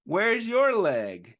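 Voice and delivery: slow, deliberate question; asking the viewer. Shown as a demonstration. Teacher asks the children slowly about Joe's leg. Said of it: "let Mister Nathan ask you".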